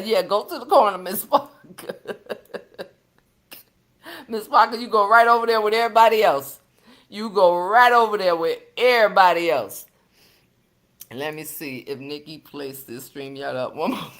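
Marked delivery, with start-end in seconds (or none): 4.3-9.9 mock scolding. Real, not acted. Sending viewer to the corner.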